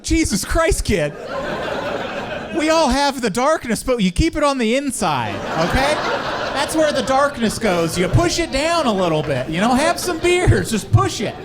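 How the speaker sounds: high-pitched appalled advice; high mock-horrified pep talk; mock alarmed counsel. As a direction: counsel the kid in a high, urgent voice. Acted shocked advice to the kid.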